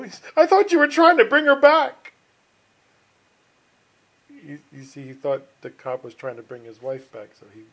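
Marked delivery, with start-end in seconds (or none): 0.4-2.1 very loud, high-pitched joke voice; exclaimed punchline. Acted. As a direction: cry it out in hammed-up alarm.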